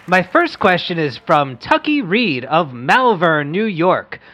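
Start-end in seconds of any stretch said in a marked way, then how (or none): none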